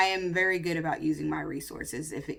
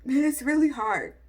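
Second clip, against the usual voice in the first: tearful; 6 semitones higher, 3 dB louder than usual. Real, not acted. Emotional confession, voice strained.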